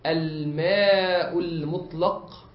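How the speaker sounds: stretched out word by word, very slow; slow teaching emphasis. Shown as a demonstration. Teacher to students, drawing out the term slowly. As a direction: spell out الماء المطلق slowly so students catch it. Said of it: "لكن عندما أقول".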